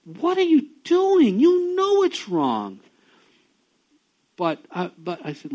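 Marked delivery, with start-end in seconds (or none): 0.0-2.8 high, loud, slow scolding; exasperated rebuke. Retold or imitated. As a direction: shut a friend down with a rebuke. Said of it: "I'm like".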